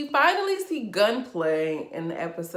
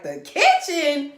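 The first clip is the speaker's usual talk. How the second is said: outraged disbelief; 9 dB louder than usual. Retold or imitated. Reacting to Amara's mom in the kitchen, loud outraged disbelief. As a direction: shout it like you can't believe the sixties are back. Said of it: "I'm like".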